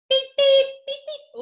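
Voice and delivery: high, loud beep beep like a bus; bus horn beeps. Pretend. Teacher to kids, a bus beeping. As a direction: honk it like a little bus horn.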